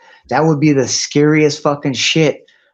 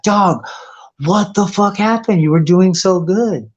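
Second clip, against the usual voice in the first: shocked disbelief; 6 semitones higher than usual. Retold or imitated. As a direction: call out to a friend you barely recognize. Friend shocked by tweaker's decline.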